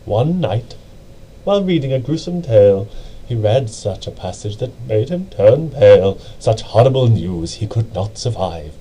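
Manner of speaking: usual speaking voice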